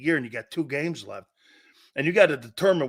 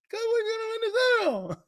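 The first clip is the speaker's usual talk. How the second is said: much higher, fast squeaky puppet voice; ventriloquist puppet voice; faster than usual. Retold or imitated. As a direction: play Romo as a dummy on Jerry's lap. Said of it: "like a ventriloquist".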